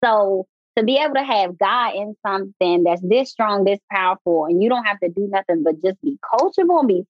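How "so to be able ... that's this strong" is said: ordinary talk, nothing marked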